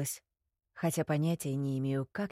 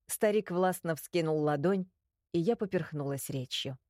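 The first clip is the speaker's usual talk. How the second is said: ordinary delivery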